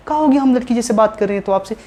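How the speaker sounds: man doing a woman's voice. Pretend. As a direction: put on a girl's voice, teasing.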